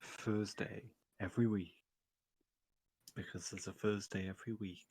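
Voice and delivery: low, soft radio announcer voice; smooth jazz announcer. Acted. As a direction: croon it like a late-night jazz DJ. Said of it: "smooth jazz announcer voice".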